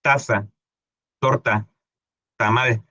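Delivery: "taza, torta, tamal" with a crisp opening t; words spaced out to show the t; demonstrated T sound. Shown as a demonstration. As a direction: lean on the t of each word, "taza, torta, tamal". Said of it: "el de la T".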